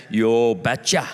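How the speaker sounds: low, slow Norwegian father; thick Norwegian accent. Acted. Norwegian father answering a suitor, thick Norwegian accent. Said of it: "in his thick Norwegian accent".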